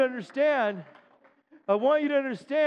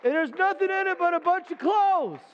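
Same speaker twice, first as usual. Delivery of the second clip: higher, slow, rising exhortation; preaching at full force; 8 semitones higher than usual. Real, not acted. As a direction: urge them to come along. Closing exhortation.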